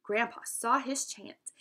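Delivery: plain reading voice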